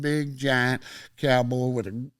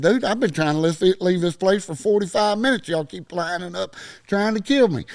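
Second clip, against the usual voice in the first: higher-pitched, aggrieved retort; exasperated protest; 6 semitones higher than usual. Retold or imitated. Teenager answering owner who orders him out, exasperated protest. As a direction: argue back, retelling your frustration.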